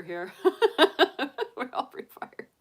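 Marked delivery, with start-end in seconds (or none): 1.6-2.5 whispered, breathy repeat of the line. Shown as a demonstration. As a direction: breathe the words out like a secret.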